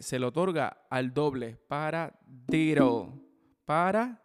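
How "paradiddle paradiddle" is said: Paradiddle drawn out slowly, "para", then "diddle"; split into parts. Shown as a demonstration. Teacher showing how the term combines single and double, split into parts. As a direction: say Paradiddle loudly in two separated chunks. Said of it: "el para simplemente es para poder".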